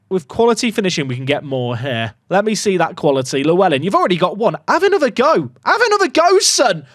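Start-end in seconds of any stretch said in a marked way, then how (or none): 4.7-5.5 high, louder urging; excited urging. Real, not acted. Urging player to score again. 5.6-6.8 much higher, louder urging, rising at the end; rising excited cheer. Real, not acted. Urging player again, stronger.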